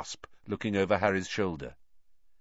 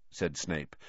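plain reading voice

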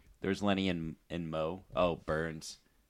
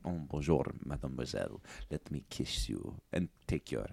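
Pepe Le Pew; 9 semitones lower, 13 dB quieter than usual. Acted. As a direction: croon softly like a French seducer. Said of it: "You're like".

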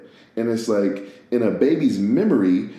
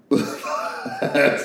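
breathy laugh; 4 semitones higher than usual. Real, not acted. Speaker laughing at getting dizzy from thinking, breathy laugh. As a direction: burst of airy laughter. Cracks up at own spins.

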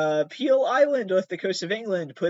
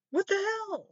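a high, stunned yelp of disbelief; stunned high what the hell. Real, not acted. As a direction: squeak it out in disbelief, much higher. Stunned by the dates.

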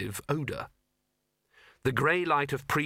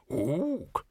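short ape grunt-word; a librarian ape's Ook; orangutan ook; 4 semitones higher than usual. Acted.

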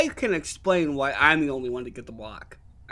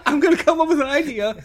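laughing; 8 semitones higher, 4 dB louder than usual. Retold or imitated. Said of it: "It was like".

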